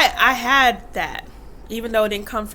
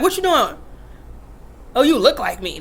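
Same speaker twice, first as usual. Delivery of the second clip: louder, blunt mock rough father; gruff dad; 3 dB louder than usual. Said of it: "that's how he talked to me".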